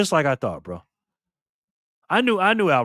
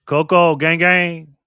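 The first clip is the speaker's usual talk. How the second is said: hype call; 5 semitones higher, 8 dB louder, much slower than usual. Real, not acted. Hyping a winner in chat.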